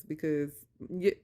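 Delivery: ordinary talk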